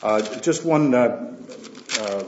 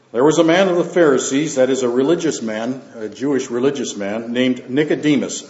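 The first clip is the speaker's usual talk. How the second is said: raised, measured Bible reading voice; scripture reading; 5 dB louder than usual. Acted. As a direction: read the Gospel out to the church with weight. Reads scripture aloud to congregation.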